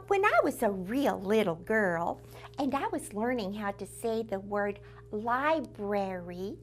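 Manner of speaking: usual speaking voice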